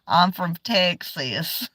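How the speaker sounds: slow, drawn-out Southern Texas twang; light Southern drawl on request; Southern accent. Shown as a demonstration. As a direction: say it like a Texan, slow and proud. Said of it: "oh, you want me to say it?".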